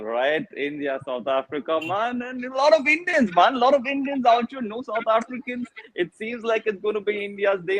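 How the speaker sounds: put-on laid-back Caribbean lilt, West Indian accent; hammed-up West Indian accent, man. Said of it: "where is the West Indian accent?".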